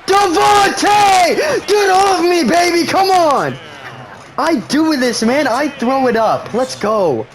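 high, loud screaming, easing off toward the end; excited screaming. Real, not acted. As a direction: scream at the receiver, then come down. Cheering a deep throw.

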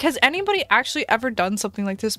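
ordinary talk, nothing marked

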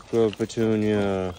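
loud, drawn-out praise; slow, doting praise for an animal; praising a pet. Real, not acted. Keeper rewarding Daisy the pig for sitting, drawn-out praise.

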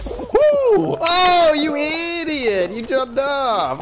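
high, slow wailing squeal; shrieking dismay. Real, not acted. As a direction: wail at the teammate's blunder. Teammate blunders in game.

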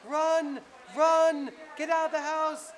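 panicked grandmother yelling at her grandson to run. Retold or imitated. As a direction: shriek like a terrified grandma urging you to flee.

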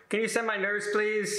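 high, pleading voice for the nurse; roommate's request. Retold or imitated. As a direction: ask for the nurse in a high whine. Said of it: "Nurse comes on and says".

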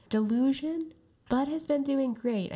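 high, loud disbelief; indignant high-pitched protest; incredulous outrage. Real, not acted. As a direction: leap to a favourite's defence, pitch shooting up. Outraged defence of favourite player.

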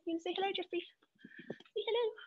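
pet talk. Real, not acted. Coaxing the dog to greet.

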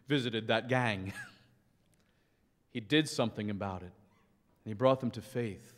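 ordinary talk, nothing marked

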